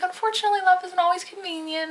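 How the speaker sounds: high, pointed, sarcastic and loud; sarcastic retort. Retold or imitated. To the guy missing love, high sarcastic reply. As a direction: hand him the lesson with mock patience.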